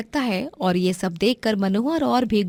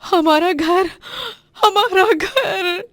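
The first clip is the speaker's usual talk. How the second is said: crying; 10 semitones higher, 3 dB louder, much slower than usual. Acted. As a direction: voice rising into a slow, breaking wail. Home burnt down, wife cries. (रोते हुए धीमी टूटती आवाज़)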